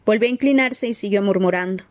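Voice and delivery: usual speaking voice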